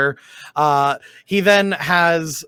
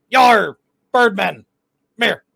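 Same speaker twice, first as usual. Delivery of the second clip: pirate voice; 4 semitones higher, 4 dB louder than usual. Acted. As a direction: growl it like an old pirate.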